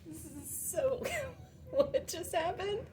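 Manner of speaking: squeaky laugh through the words; high laughing disbelief. Real, not acted. Laughing at the mishap.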